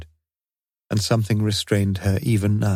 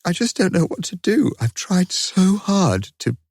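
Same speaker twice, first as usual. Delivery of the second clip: barely holding back the sobs; quivering, tearful; 11 semitones higher than usual. A narrator's reading. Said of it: "tried to steady her quivering voice".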